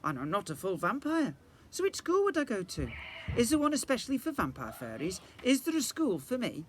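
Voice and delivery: rising worry as she asks; worried girl. A narrator's reading. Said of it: "I said".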